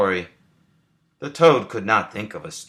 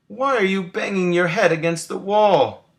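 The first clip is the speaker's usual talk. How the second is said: high, bewildered frog asking; Frog's voice; 10 semitones higher, 3 dB louder than usual. A narrator's reading. Frog asks Toad about the wall, high and bewildered. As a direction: pitch way up for a baffled Frog. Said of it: "asked Frog".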